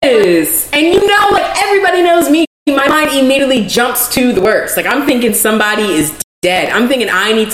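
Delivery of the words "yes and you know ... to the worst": high-pitched, emphatic venting; exasperated, voice shooting up high; worked-up agreement. Real, not acted. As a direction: burst in with fervent agreement.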